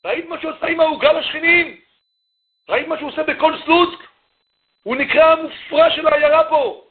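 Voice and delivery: high, angry ranting father. Retold or imitated. Father rants to mother about the boy, high and heated. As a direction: let the father's exasperation pour out. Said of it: "הוא שומע את אבא אומר".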